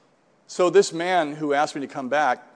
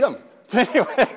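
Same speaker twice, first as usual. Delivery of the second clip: laughing through the words, faster; 9 semitones higher, 3 dB louder than usual. Real, not acted. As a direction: chuckle as you finish the thought. Laughs at beating older teams.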